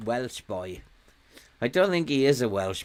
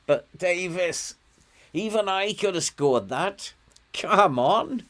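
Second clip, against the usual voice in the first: exasperated; 6 semitones higher than usual. Real, not acted. Frustrated at missed easy chance.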